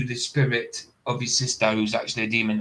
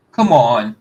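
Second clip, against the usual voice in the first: ordinary talk, nothing marked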